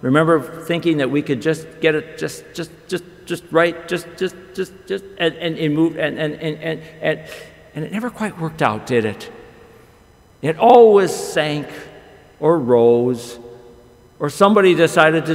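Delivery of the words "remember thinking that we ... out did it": usual speaking voice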